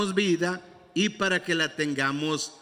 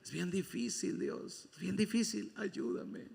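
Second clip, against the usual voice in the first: softer, half-whispered begging plea; imitated pleading prayer; 9 dB quieter than usual. Preacher imitating his old whispered plea to God. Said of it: "yo oraba así".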